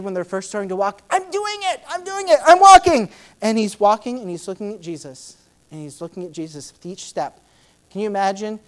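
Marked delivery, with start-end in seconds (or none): 1.1-3.1 put-on high, thrilled childlike squeal; excited little kid. Peter walking on water like a thrilled toddler, excited. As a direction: cheer like a toddler's first steps. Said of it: "like how a little kid".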